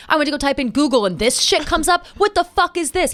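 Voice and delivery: a father confronting his daughter, mock yelling; imitating dad yelling. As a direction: replay an angry father's rant lightly. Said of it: "He's yelling at me".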